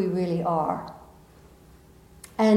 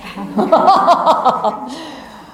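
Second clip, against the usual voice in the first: higher, louder laugh falling off; 5 semitones higher, 6 dB louder than usual. Real, not acted. Laughing after oh my gosh.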